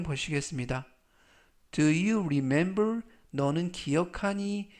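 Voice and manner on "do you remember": Your belongings said very slowly; slow model reading. Shown as a demonstration. Teacher reading the phrase to students, very slow. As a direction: stretch Your belongings out for learners. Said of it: "slow model reading".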